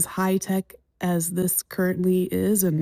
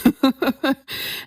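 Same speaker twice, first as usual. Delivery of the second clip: loud high burst of laughter; 7 semitones higher, 5 dB louder than usual. Real, not acted. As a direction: break into a big laugh. Laughing at own excitement.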